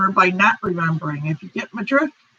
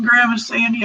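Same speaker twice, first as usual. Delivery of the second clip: usual speaking voice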